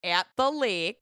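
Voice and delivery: "at the lake" slow, drawn-out Minnesota vowels; Minnesota accent. Shown as a demonstration. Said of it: "we'll break it down".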